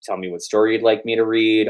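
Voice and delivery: plain reading voice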